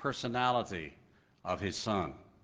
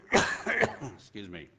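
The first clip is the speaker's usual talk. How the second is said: ordinary delivery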